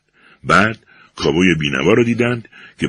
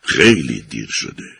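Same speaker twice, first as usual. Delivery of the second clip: sorrowful; 6 semitones lower than usual. A narrator's reading. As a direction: sadly break the news. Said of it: "با اندوه سر تکان داد و گفت".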